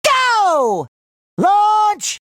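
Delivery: high, drawn-out command; launch call. Acted. Calling out a launch.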